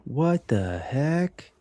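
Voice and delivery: high, slow, stretched disbelief; surprised. Real, not acted. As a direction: drag out the shock. Surprised at opponent's card.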